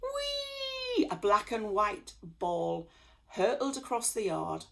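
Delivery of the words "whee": very high joyful squeal; gleeful whee. A narrator's reading. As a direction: squeal with glee. Cat hurtles across the yard.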